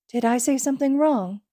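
put-on high, small toddler voice; a little boy unsure of himself; worried child voice.

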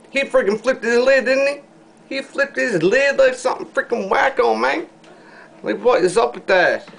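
high excited outburst about a worker flipping out; incredulous rant. Real, not acted. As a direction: go way up in pitch, outraged amusement. Ranting about the KFC worker.

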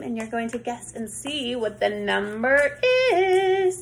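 ordinary talk

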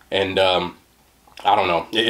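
normal talking voice

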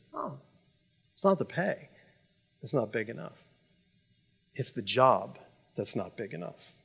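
soft, low answer with long pauses; hushed, measured acted refusal; quiet reply. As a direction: let it land gently and gravely. Missionary calmly answers the angry messenger.

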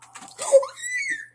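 loud, high-pitched shriek of a gasp; startled gasp. Real, not acted. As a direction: gasp sharply at a sudden scare. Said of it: "that scared me".

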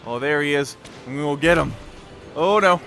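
ordinary delivery

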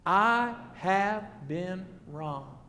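very slow, word by word; solemn confession. Shown as a demonstration. Preacher asking congregation to join his confession, slow and measured. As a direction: lead them slowly through each word.

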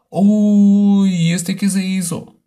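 startled uy. Real, not acted. Startled by something in game.

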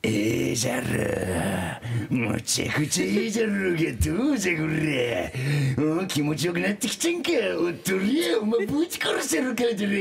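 mock-macho Hiroshima dialect, rising toward a yell; rough Hiroshima dialect. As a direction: talk dirty in tough Hiroshima dialect. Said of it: "言う時".

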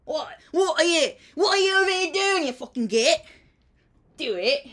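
loud, high British hooligan rant; football hooligan. Acted. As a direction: pick a fight like a rowdy football fan. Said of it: "you do the football hooligan".

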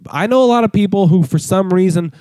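normal talking voice

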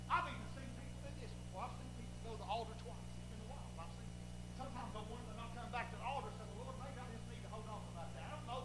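soft, rushed muttering; fast quiet aside. Real, not acted. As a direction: rattle it off softly under the breath.

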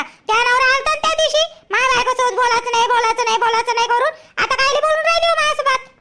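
indignant retort. Acted. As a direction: bristle and throw the words back at her. Offended friend snaps back.

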